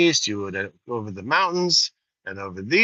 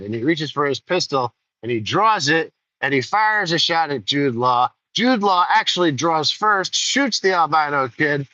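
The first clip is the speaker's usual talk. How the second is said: usual speaking voice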